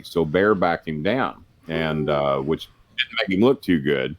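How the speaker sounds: ordinary talk, nothing marked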